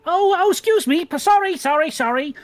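high, loud and flustered apologies; a flustered little gnome hurrying to apologise; squeaky panicked apology from upstairs. Acted. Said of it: "from around the corner you hear".

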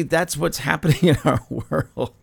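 chuckling through the line; laughing. Real, not acted. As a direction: let a real laugh break into the words. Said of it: "I shouldn't laugh".

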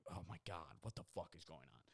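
low, soft bystander aside; retold hushed alarm. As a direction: say it under your breath, imitating alarm. Bystanders react under their breath.

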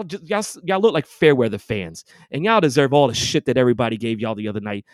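ordinary delivery

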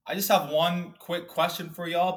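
upbeat podcast host opening the episode; podcast intro. Acted. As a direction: welcome the listeners like a show host.

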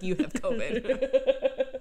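slow laughing readout; drawn-out, laughing verdict; laughing announcement. Retold or imitated. Pink test reading out loud.

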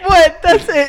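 high, loud indignant; loud protest. Real, not acted. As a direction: protest loudly. Protesting loudly.

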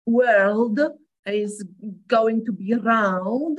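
"World is going to be round" spelled out sound by sound, heavy Polish accent; exaggerated literal pronunciation. Shown as a demonstration. Polish speaker showing how Poles pronounce English, exaggerated. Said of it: "I'm exaggerating right now".

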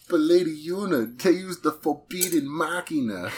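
high, loud put-on Polynesian accent; Wakka's Polynesian accent. Player quoting Wakka for the table, hammed-up Polynesian accent. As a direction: exaggerate an island Polynesian accent, pitched well up. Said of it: "Final Fantasy X, Wakka".

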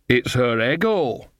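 slow, lightly voiced country witch; matter-of-fact Nanny Ogg. A narrator's reading. Nanny Ogg explains Granny to Magrat, matter-of-fact. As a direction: state it steadily, at an everyday pitch.

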